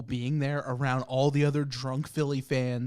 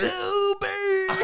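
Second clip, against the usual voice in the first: screamed Go Birds at full blast; drunk fan scream; 4 dB louder than usual. Retold or imitated.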